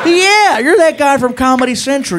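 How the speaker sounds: high, loud burst of recognition; imitated excited cop. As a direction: gush like a starstruck cop.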